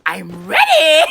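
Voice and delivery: slow, low, drawn-out declaration; hammed-up low triumph; mock menacing boast. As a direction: announce it low like a playful villain. Announces he is armed now.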